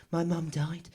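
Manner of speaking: just say the plain words quietly; quiet admission. Real, not acted. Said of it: "say those words out loud".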